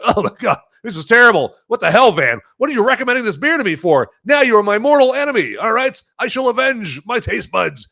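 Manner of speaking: high-pitched, hammed-up indignation; mock outrage. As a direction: melodramatic complaint from a betrayed drinker. Acting a disgusted viewer's complaint.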